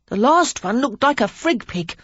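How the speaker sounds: put-on a cheeky boy showing off a rude word; boy Henry. A narrator's reading. Young Henry mocking his old tutor, high and cheeky.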